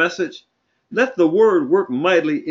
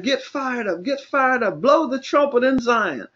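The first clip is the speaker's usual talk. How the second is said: high, rousing preacher's exhortation; rally them, pitch raised and ringing; fired-up preaching; 8 semitones higher than usual. Real, not acted. Preacher sounding the alarm in Zion, fired-up preaching.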